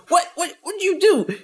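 high, stuttering shock at a sudden healing; stunned disbelief. Retold or imitated. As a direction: imitate a man who can't believe his eyes. Stunned healed man retold.

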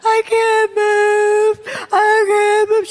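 high, drawn-out mock wail; helpless wail. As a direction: wail it slowly, acting out helpless panic. Acts out her helpless wail.

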